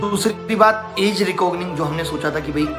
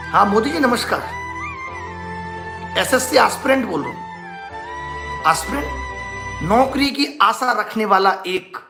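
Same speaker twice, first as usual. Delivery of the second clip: mock complaint call; 5 semitones higher than usual. Mock phone call complaining to Modi.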